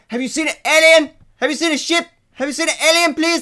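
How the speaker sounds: fed up, high-pitched "please?"; upset demand. Real, not acted. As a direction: demand it, voice shooting up. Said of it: "Sorry, I am upset".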